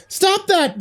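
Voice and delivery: high, urgent cry. Acted. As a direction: call out sharply to stop him. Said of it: "the girl cried".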